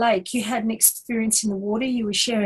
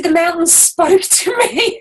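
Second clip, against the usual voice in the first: higher and louder, announcing a marvel; the friend proclaiming her wonder; imitating amazed friend; 8 semitones higher, 6 dB louder than usual. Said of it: "She said".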